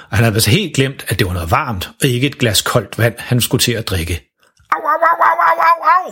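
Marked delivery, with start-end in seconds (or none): 4.7-6.1 high-pitched yelps of pain; yelping ow ow ow after a hot sip; mock pained yelps, much higher; ouch from a burned tongue. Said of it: "råber han, da han brænder sig på tungen".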